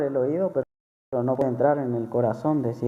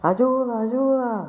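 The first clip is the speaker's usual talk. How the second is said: retold cry for help; 10 semitones higher, slower than usual. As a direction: echo her cry for help, lightly, in a higher voice. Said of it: "Mira a la hermana así gritando".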